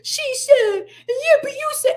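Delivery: mocking a whiny commenter, high and tearful. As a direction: squeal it like a whining critic. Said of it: "y'all in my comments".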